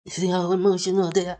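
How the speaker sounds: high mocking whine of the caller's words; sneering impression of an emotional caller.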